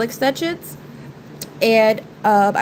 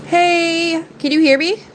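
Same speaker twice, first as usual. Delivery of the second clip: high raised call; 8 semitones higher, slower than usual. Real, not acted. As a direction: call out to someone in another room. Calling out to someone.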